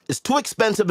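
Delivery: mock complaint in a high voice; high questioning whine. Shown as a demonstration. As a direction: ask it up high like a whiny complaint. Repeated in two deliveries.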